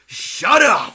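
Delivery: loud, high yell; mock angry shout. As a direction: shut the whining kid down. Shouts down the mocked child.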